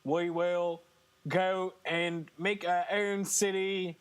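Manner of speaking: hammed-up bad Australian imitation; weird Australian accent. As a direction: put on a clumsy Australian accent. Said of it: "this weird Australian accent".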